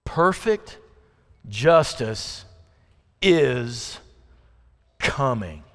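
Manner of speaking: soft, low, word-by-word promise; slow emphatic pronouncement. Real, not acted. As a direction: let each word land alone. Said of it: "Here's how you survive it".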